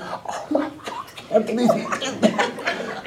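whispered envious disbelief, acted out; inward groaning whisper. Envious friend's secret thought during congratulations, whispered. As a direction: hiss an inner thought under your breath. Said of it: "Meanwhile, going".

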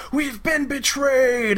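high, slow, hammed-up cry of betrayal; mock shout. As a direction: wail it out like a doomed warrior. Vikings realise the monk betrayed them.

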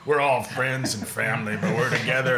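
low, slow mock tough-guy drawl; mock Vin Diesel. Friend mocking Vin Diesel's family talk in low voice. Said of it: "He's just like".

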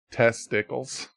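slow, deadpan pager call to the front desk; page a guest like a hotel front desk, slowly. Pretend. Mock paging announcement.

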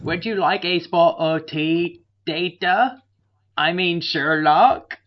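slow, high, hammed-up English parody; overdone fake-English tea-server voice; bad English accent. Offering the detective tea, bad English accent. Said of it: "his English accent is fucking terrible".

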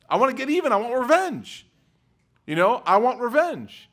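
high, loud mock whine for revenge; high petty voice acting out revenge. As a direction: play the petty child wanting payback. Mimicking petty vengeful self.

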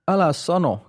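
high, sharp snapped retort. A narrator's reading. Old man snapping at the doubting warrior, sharp high snap. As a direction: bite the words off, sharply. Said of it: "kivahti vanhempi mies".

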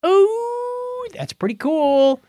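ordinary talk, nothing marked

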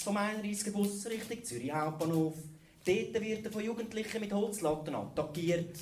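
high, eager local news reporter. Acted.

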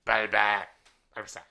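creature call of the name; Bulbasaur cry. Pretend. Podcaster shows off his Bulbasaur cry to his cohost. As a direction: squawk it like the Pokemon. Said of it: "I do a pretty good Bulbasaur".

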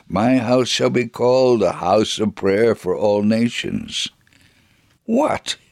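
plain reading voice

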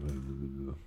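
low, quiet monotone drone; Doc Parsley. Retold or imitated. Mocking the podcast doctor's lack of variation, monotone. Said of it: "he's so monotone".

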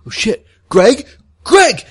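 high, rising urgent shout; urgent calling out. Acted. Andy calls Greg over the radio, urgent shout.

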